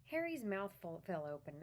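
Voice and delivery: reading the book aloud, steady storyteller pace; story narration. A narrator's reading. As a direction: read it like a bedtime story.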